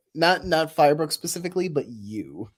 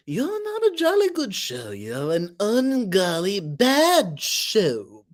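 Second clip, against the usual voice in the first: high, slow, swooping delivery; mock-indignant character voice; 7 semitones higher, slower than usual. Streamer quoting a show for chat, high theatrical scolding. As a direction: stretch it out theatrically.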